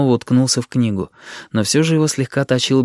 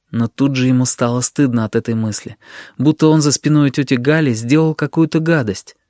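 usual speaking voice

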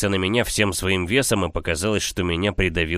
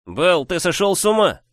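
alarmed; 9 semitones higher, 4 dB louder than usual. Acted. Brother pleads in shock.

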